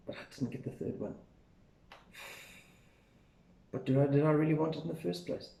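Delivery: very soft, almost whispered thinking; inner thought. Shown as a demonstration. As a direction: think it to yourself, barely aloud. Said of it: "three sentences to think there".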